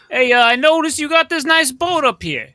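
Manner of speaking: high, loud in-character hail; accosting a stranger. Acted. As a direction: call out a pushy opener to the captain. Character accosts the boat's captain.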